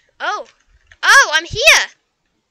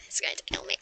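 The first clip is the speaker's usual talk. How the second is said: laughing whisper; 12 dB quieter than usual. Real, not acted. Dragon attacking her.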